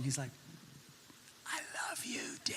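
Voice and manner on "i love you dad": put-on whispery slow-motion child. Son to his dad in the dream, slow-motion whisper. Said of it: "it was all slow motion".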